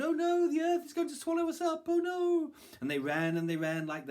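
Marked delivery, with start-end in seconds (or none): 0.0-2.5 very high, loud cries of alarm; panicked calling out. A narrator's reading. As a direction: shout the warning as a fleeing crowd. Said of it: "calling out".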